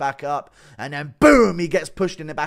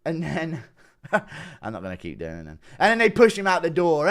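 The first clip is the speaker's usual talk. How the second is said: normal talking voice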